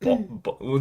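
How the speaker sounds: usual speaking voice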